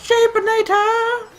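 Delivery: high, loud gleeful yell; excited shout. Real, not acted. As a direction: shout it out with glee. Shouting weapon name excitedly.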